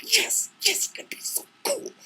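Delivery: tiny high squeal, mostly breath; suppressed excitement. Retold or imitated. Hero told to calm down, hushed giddy excitement. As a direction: squeak it softly, barely containing the thrill.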